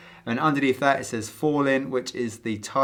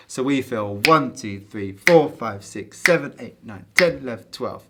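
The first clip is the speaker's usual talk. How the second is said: counting twelve eight with accents on the big beats; counting with pulse accents; 5 dB louder than usual. Shown as a demonstration. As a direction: stress one, four, seven and ten. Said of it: "We feel the dotted quarter notes".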